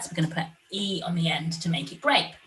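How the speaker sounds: ordinary talk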